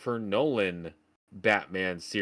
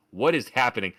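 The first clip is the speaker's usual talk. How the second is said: ordinary delivery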